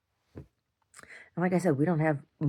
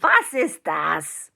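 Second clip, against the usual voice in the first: mock German; 4 dB louder, much slower than usual.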